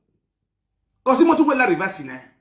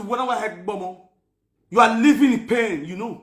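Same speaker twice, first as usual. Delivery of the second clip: normal talking voice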